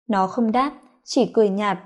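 plain reading voice

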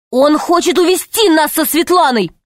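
put-on high, urgent boy's plea; desperate explaining. A narrator's reading. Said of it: "в полном отчаянии пытался растолковать". (отчаянно втолковывает, высоким голосом)

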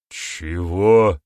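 loud startled gasp of a question; shocked gasp. A narrator's reading. Boryata reacts to the outrageous demand, shocked gasp. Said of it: "ахнул Борята".

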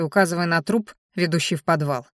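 normal talking voice

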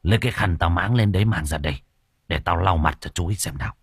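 put-on an old healer barking orders at the lads; low hurried commanding voice; master giving orders. A narrator's reading. Master groans and gives orders.

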